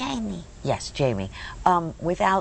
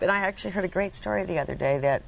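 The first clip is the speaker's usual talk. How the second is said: ordinary talk, nothing marked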